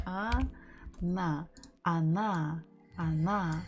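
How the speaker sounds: А, на slowly, then она with an A sound; syllable-by-syllable. Shown as a demonstration. Teacher builds она piece by piece, slow. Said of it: "this is how we're supposed to say it".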